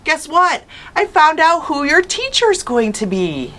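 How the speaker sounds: high, bright-pitched eager mother voice; bursting with good news; excited mom. A narrator's reading. Mother calling up the stairs with news, high and excited. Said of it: "She sounded excited".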